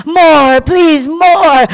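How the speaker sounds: eager begging. Acted. Children beg for more.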